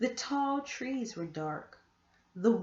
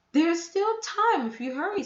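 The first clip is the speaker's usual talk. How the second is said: aunt's voice; 8 semitones higher, 7 dB louder than usual. A narrator's reading. Aunt voiced in story.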